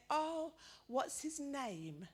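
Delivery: put-on slow, sweet question to a toddler; old lady asking Ben the baby's name. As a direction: ask the little boy kindly, drawn out.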